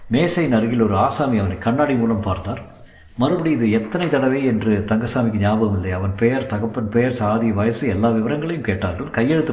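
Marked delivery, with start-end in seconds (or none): none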